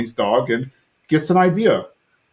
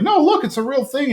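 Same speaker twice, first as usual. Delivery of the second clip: put-on much higher insisting kid voice; host voicing the son defending himself, high-pitched; imitating Bobby; 12 semitones higher than usual. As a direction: plead it up high like a defensive boy. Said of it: "Bobby's like".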